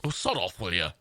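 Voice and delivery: put-on low-set old Liverpool accent; old-school Scouse, pitch falling away; deep old Scouse. Shown as a demonstration. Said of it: "this sort of deep Scouse".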